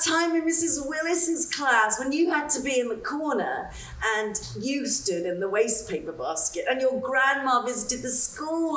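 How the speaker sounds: a woman forcing cheer while telling her friend of her loss; Betsy on the phone. Acted. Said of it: "reading the part of Betsy".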